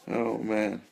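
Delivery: ordinary talk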